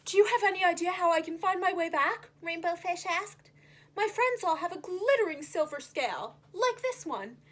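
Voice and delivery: lightly voiced hopeful fish; Rainbow Fish. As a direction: ask for help hopefully.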